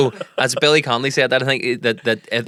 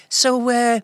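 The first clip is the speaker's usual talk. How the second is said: higher Belfast Filipino twang, lightly voiced; playful higher Belfast voice of a young server; Belfast Filipino girl; 13 semitones higher than usual. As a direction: imitate the KFC girl's Belfast lilt, pitched up. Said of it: "she says, um".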